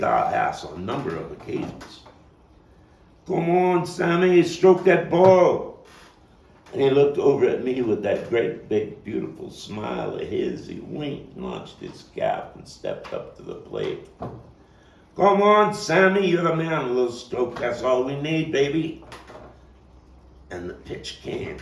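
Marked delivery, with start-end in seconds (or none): 3.3-5.8 loud, high encouraging shout across the street; calling out to batter. Acted. Kid cheering Sammy at the plate, shouting loud and high. 15.1-19.0 put-on high, loud cheer that eases down toward baby; cheering shout. Kid yelling encouragement to Sammy before the pitch, loud then easing. Cheering batter at stickball game.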